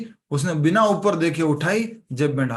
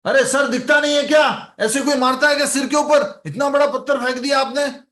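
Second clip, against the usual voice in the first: high, loud indignant outburst; angry complaint; 9 semitones higher, 4 dB louder than usual. Retold or imitated. Worker shouting at the engineer who threw the pebble, indignant. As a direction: yell up at him, outraged over a pebble.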